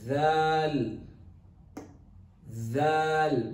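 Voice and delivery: zal long and loud, tongue pushed out. Shown as a demonstration. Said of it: "Bring out your tongue".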